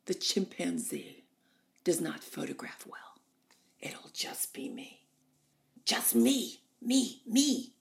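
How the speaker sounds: slow husky diva rising to a self-loving cry; Norma Desmond. Retold or imitated. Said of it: "She said".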